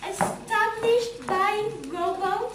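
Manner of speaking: slow, word-by-word reading aloud; halting reading. Acted. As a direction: pick slowly through a newspaper headline.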